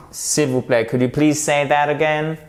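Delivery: ordinary talk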